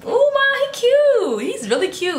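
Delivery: high drawn-out squeal of admiration; retold gushing. Teen telling her mom a boy is cute, gushing. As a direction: gush it high and stretched out.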